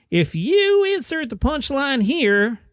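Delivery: slow, high-pitched comic setup voice; half-ass Jeff Foxworthy. Shown as a demonstration. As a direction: start way up high, drawn out slowly. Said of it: "you start high".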